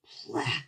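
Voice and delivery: whispered bleh of disgust. Acted. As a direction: gag it out softly. Child forced to eat candy.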